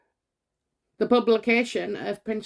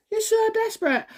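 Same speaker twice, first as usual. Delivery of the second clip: "So" pitched much higher in a jeering sing-song; mocking taunt; 11 semitones higher than usual. Real, not acted. Psychic taunting the Guardian as desperate, jeering. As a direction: jeer at them, higher and mocking.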